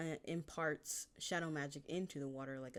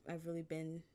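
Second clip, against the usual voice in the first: ordinary talk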